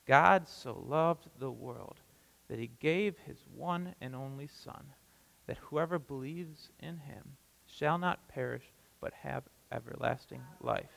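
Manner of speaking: slow, soft, deliberate reading of the verse. Shown as a demonstration. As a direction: let each phrase sink in, slowly and quietly. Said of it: "let's say a little bit slower".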